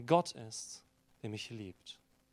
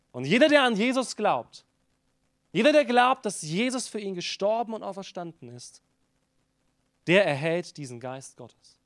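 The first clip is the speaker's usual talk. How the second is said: higher, louder preacher's cry; preaching at full force; 5 semitones higher, 7 dB louder than usual. Acted. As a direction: proclaim it to the back row. Preaching climax on believers.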